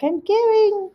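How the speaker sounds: very high, drawn-out mock wail; high-pitched pretend cry of alarm; imitating sliding mother. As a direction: repeat it in a high comic wail, like slow motion. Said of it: "She's like".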